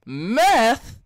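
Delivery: full hammed-up rapper shout of "Meth?"; [name] voice. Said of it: "[name] voice".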